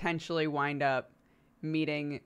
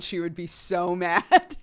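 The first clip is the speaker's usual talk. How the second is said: louder, laughing through it; 6 dB louder, slower than usual. Real, not acted. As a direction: crack up as you say it.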